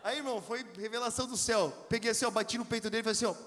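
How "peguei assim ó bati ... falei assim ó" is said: ordinary talk, nothing marked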